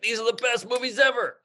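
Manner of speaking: high-pitched burst of fan excitement; retold youthful enthusiasm. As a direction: gush like a thrilled kid recalling it. Recalls his youthful enthusiasm.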